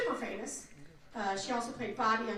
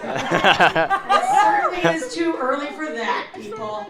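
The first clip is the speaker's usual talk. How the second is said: loud, slow, laughing protest; raised, drawn-out amused grumble; laughing complaint; 4 semitones higher, 12 dB louder, slower than usual. Real, not acted. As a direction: complain through laughter after screaming. Laughing aftermath of her own scream.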